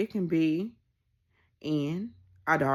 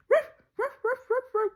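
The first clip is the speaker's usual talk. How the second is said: high mock dog barks; imitated barking; 3 dB louder than usual. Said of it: "she was barking at the table".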